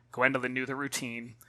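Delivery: usual speaking voice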